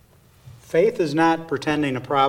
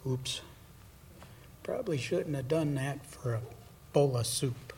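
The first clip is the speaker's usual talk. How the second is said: much softer, sheepish oops; a mild embarrassed Esau; sheepish regret; 10 dB quieter than usual. Retold or imitated. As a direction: mutter it like a sheepish sinner. Esau regretting his choice.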